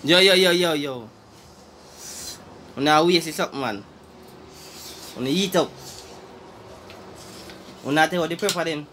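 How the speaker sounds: usual speaking voice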